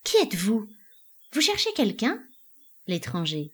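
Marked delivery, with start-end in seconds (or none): 0.0-2.2 puzzled questioning that rises at the end; astonished, uneasy. A narrator's reading. Elida questions the unknown stranger, uneasy astonishment. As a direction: question the intruder with mild alarm. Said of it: "le regardant étonnée, inquiète".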